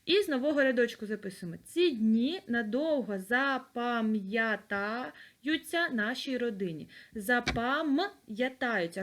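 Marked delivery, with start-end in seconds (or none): 1.6-6.9 slow, even dictation pace. Shown as a demonstration. Teacher dictates the sentence to the class. 7.1-9.0 slow "Запам'ятаються", split at the apostrophe; separated pronunciation. Shown as a demonstration. Teacher shows the apostrophe in "Запам'ятаються". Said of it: "Роздільна вимова".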